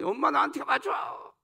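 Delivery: put-on slow, pleading childlike cry; baby calling mom. Preacher voicing a baby calling mom, slow childlike plea. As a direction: draw it out like a baby begging for mom.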